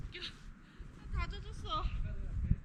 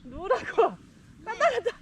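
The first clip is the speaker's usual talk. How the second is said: loud, high excited shout; 5 semitones higher, 10 dB louder than usual. Real, not acted. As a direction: shout it as you push off. Shouting as the sled starts.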